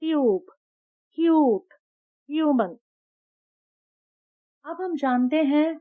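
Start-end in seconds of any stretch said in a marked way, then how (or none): 0.0-2.8 ट्यूब क्यूट ह्यूमन with a long yoo; long u sound. Shown as a demonstration. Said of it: "long vowel में हमें ये यू sound". (लंबा यू sound)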